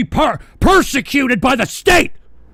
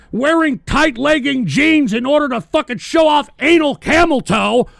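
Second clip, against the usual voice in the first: normal talking voice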